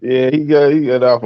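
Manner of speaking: usual speaking voice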